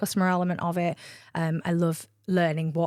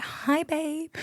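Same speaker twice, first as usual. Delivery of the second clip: mum's greeting; 9 semitones higher than usual. Retold or imitated. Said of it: "she was like".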